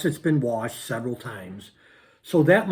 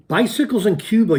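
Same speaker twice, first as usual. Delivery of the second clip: excited outburst; 7 semitones higher, 4 dB louder than usual. Real, not acted. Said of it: "you guys are laughing".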